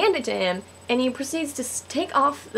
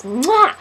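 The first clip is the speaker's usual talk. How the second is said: high, loud "mwah"; hammed-up smooch sound; exaggerated kiss noise; 6 semitones higher, 7 dB louder than usual. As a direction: act out a sudden smacking kiss. Imitates the kiss again.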